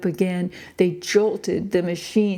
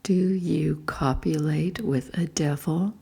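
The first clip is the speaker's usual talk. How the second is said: whispered; 2 semitones lower than usual. A narrator's reading. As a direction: breathe the question into her ear.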